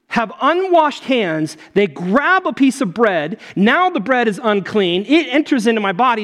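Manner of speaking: high-pitched, insistent disbelief; incredulous challenge. Retold or imitated. Pastor voicing Jesus questioning Pharisees about unwashed hands, incredulous. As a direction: spell out faulty logic as if baffled.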